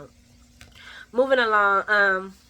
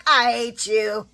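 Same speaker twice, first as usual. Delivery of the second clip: slow mock hatred; 3 semitones higher, 4 dB louder, slower than usual. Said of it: "She was like".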